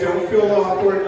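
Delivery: normal talking voice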